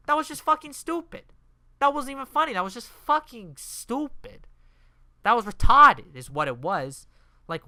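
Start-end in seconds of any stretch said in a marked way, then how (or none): 0.1-6.1 loud, high-pitched disgusted outburst; worked-up, emphatic scorn; exasperated rant. Real, not acted. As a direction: blow up at how dumb it is. Ranting about stupid cactus joke.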